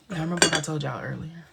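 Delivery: ordinary delivery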